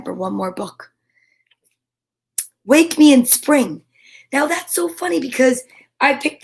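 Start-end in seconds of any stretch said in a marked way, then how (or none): none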